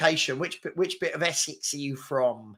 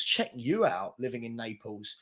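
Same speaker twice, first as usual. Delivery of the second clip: much softer, half to himself; quiet impressed aside; 6 semitones lower, 7 dB quieter than usual. Real, not acted. Streamer quietly impressed by a viewer living in Naples, soft murmur. Check you out.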